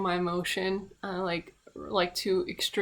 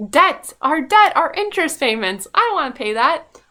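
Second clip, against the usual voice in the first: loud; 7 semitones higher, 9 dB louder than usual. Real, not acted. Ranting loudly about debt.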